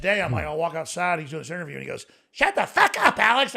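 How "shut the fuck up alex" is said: much higher, louder put-on angry voice; a hammed-up furious friend; imitating Max Keiser. Friend yelling at Alex, hammed-up angry impression. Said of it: "I can't do his voice".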